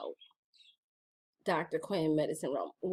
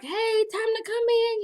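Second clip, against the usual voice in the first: mom calling out; 3 dB louder than usual. Retold or imitated. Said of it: "she would yell to us".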